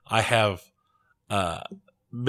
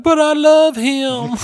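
much higher, slow, sweet little dog voice; dog's voice; 6 dB louder, slower than usual. Retold or imitated. The dog answering the crowd about its owner, high sweet voice. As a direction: coo it as the devoted dog. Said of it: "the dog is all like".

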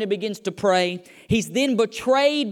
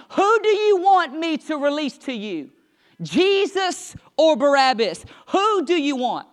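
higher-pitched, emphatic appeal to a crowd; insistent questioning; 5 semitones higher than usual. Retold or imitated. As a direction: press the crowd for an answer. Pilate questioning the crowd, acted.

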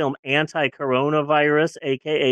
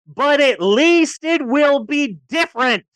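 higher, slow showman's build-up; big hype; 9 semitones higher, 3 dB louder, slower than usual. Acted. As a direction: stretch it out like a grand promise. Host hypes up next week's movie.

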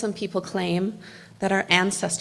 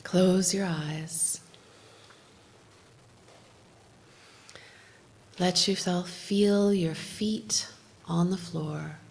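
guided meditation; 4 dB quieter than usual. Acted. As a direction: lead them gently into calm, half whispered. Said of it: "do a little bit of guided meditation".

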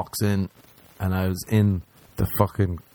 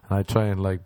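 ordinary delivery

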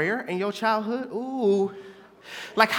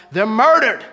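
preacher punching murdered, louder; louder repeat; 7 dB louder than usual. Acted. Preacher repeating to congregation, louder emphasis. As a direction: hit murdered hard.

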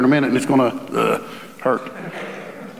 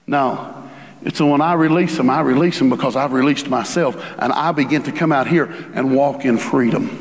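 normal talking voice

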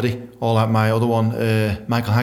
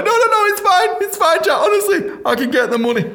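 high, squeaky flustered denial; nervous over-eager promise; panicky reassurance; 4 dB louder than usual. Retold or imitated. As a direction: talk fast to calm a threat. Lying after a threat.

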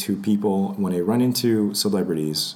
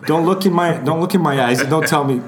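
mock tirade, raised and loud; bossy celebrity; 4 semitones higher, 4 dB louder than usual. Celebrity laying down rules for fans, comically angry. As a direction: rattle off hammed-up prohibitions.

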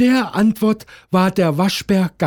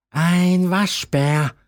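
owl answering; slower than usual. A narrator's reading. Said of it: "antwortete die Eule wieder".

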